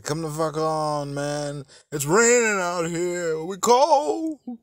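high, drawn-out mock whine; hammed-up pleading zombie; complaining zombie. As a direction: wail it like a zombie stuck in the rain. Voices zombies complaining in the rain.

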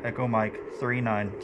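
usual speaking voice

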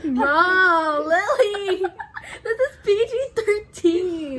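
shrieking through laughter; high laughing protest; laughing outburst. Real, not acted.

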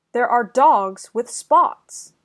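ordinary delivery

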